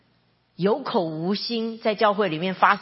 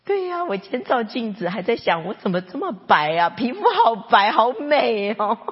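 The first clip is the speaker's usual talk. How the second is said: lightly voiced upbeat mother; playing cheerful mom; 5 semitones higher, 5 dB louder than usual. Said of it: "然后妈妈就这样说".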